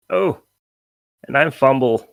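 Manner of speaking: a touch of a small pixie voice; pixie Fumble. Acted. Game master as pixie who fell face first, lightly voiced introduction. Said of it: "and says".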